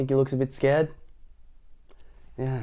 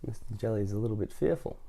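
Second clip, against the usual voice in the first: usual speaking voice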